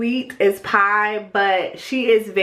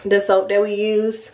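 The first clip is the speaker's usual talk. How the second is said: usual speaking voice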